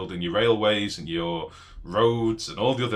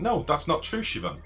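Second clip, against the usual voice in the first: ordinary talk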